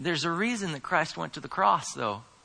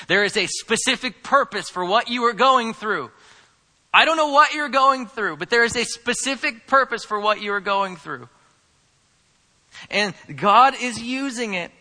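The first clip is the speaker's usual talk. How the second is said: loud, high, urgent preacher's drive; rising passionate sermon delivery; fervent preaching; 7 semitones higher, 6 dB louder than usual. Acted. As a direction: press the point home to the congregation. Preaching at full force.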